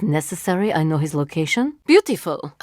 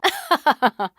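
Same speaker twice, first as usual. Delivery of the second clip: laugh; 9 semitones higher than usual. Acted. Short laugh while reassuring.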